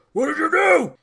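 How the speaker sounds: high, very loud yell as Thor; full acted shout. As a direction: shout it out, far above normal voice. Acted quote of stunned Thor.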